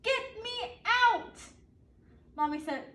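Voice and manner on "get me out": put-on slow, high, louder wail of a child; shouted. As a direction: stretch it out, stuck in the cart and fed up. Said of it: "in the end I shouted".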